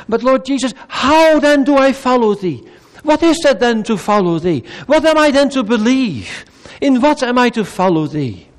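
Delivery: a hint of a desperate believer; pleading believer. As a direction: cry out to the Lord as a seeker. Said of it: "Say it to him".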